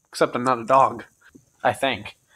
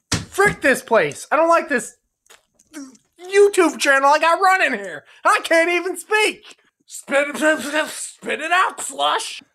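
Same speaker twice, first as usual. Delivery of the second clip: mock frustrated; 8 semitones higher than usual. Frustrated outburst.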